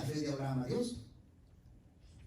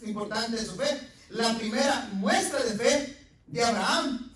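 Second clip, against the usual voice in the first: full-force preaching; 8 semitones higher, 9 dB louder than usual. Acted.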